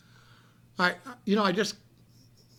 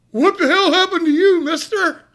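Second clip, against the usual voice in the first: much higher and louder, alarmed; shocked clerk; 10 semitones higher, 10 dB louder than usual. Retold or imitated. Hotel clerk seeing the bleeding man, shocked high cry. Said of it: "he says".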